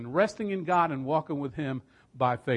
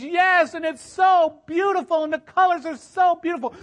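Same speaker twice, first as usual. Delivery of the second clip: awestruck cry; 13 semitones higher, 8 dB louder than usual. Retold or imitated. Dying mother seeing heaven, loud awestruck wonder. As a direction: gasp it out, overwhelmed by beauty.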